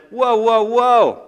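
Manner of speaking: loud, high protest; indignant objection. Acted. Judas complaining about the costly perfume, loud protest. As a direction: burst in high and loud to halt everything.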